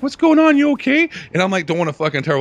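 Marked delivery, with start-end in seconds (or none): 0.0-1.1 woman's voice. Retold or imitated. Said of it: "she's like".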